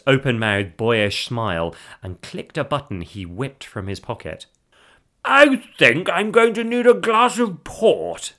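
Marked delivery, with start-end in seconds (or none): 5.2-8.3 high, loud, drawn-out announcement; showman husband. Acted. Husband preparing himself to perform, loud theatrical showmanship. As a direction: announce it grandly, pitched up high and slow. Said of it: "said Sean as he mentally prepared himself".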